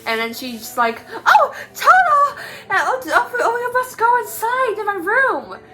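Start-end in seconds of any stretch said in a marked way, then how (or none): 1.3-5.6 Dorothy voice. Retold or imitated.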